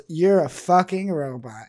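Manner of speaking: loud retold outburst, held in check; retold yell. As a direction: imitate someone yelling an insult. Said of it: "he just yells".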